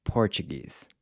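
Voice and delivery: ordinary talk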